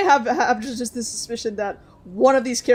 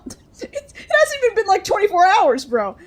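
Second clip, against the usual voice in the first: laughing rant; 8 semitones higher, 5 dB louder than usual. Acted. Character ranting, laughing.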